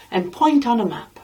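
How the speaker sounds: ordinary delivery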